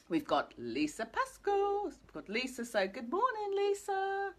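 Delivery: high, drawn-out sing-song hello; sing-song greeting. Real, not acted. Host welcomes Lisa joining the live stream, sing-song greeting. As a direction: call out a cheery welcome, pitch climbing.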